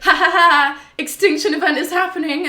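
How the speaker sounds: loud, high gloating villain laugh and boast; villain cackle. Retold or imitated. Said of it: "Higgs is like".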